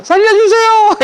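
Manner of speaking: high, frantic cry for life; desperate plea. Retold or imitated. King pleading with God to spare his life, desperate high cry. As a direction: beg to be saved, voice cracking up high. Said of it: "이 소리인 거예요".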